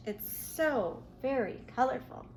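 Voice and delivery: usual speaking voice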